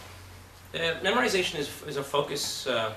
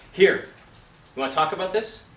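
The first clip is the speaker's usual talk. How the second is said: louder, higher challenge; expected confrontational reading; 5 semitones higher, 5 dB louder than usual. Retold or imitated. Coach imitating the scene partner the actor expected, a forceful challenge. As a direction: throw the question at him forcefully. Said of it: "he expected Danny Glover to come out and go".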